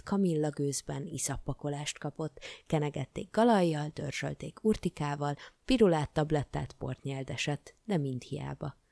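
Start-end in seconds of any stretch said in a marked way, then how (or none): none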